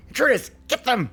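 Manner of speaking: high, loud shout at the intruders; goblin battle cry. Acted. Goblin ranger orders his wolves to attack, shouting. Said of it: "he sees you immediately and goes".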